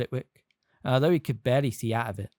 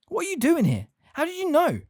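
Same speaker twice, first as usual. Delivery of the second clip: astonished. A narrator's reading. Harry astonished.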